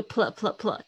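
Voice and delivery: "pl" repeated as a tight cluster; blended PL. Shown as a demonstration. Teacher drills "pl" for students, blended consonants. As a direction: sound P and L together, "pl, pl, pl". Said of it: "要一起念".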